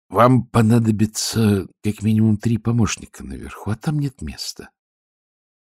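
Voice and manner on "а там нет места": ordinary delivery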